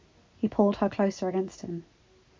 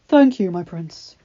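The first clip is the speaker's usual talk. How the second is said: doctor voice; 12 dB louder, slower than usual. A narrator's reading.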